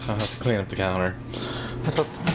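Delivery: ordinary talk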